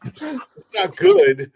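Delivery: high and very slow; slow squeaky mock line; high drawn-out catchphrase. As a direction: stretch it out, squeaky.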